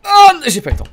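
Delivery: squealed loud and high; high-pitched exclamation. Real, not acted.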